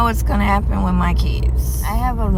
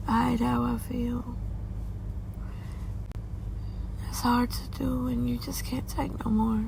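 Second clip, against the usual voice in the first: higher, softer, near tears; fighting back tears; 6 semitones higher, 8 dB quieter than usual. Real, not acted. Mother worries about her kids and bills, quietly near tears. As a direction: voice close to breaking while holding back crying. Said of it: "'cause I'm gonna cry".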